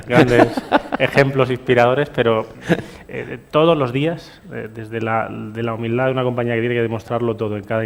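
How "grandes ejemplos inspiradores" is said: laughing through the words, louder; chuckling as you say it; real laughter breaking into the phrase. Real, not acted. Laughing while naming examples.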